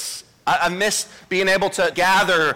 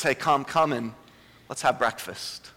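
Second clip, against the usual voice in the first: lower, softer, slow gentle call; tender invitation; 5 semitones lower, 5 dB quieter than usual. Acted. Jesus inviting Peter to breakfast, soft and tender.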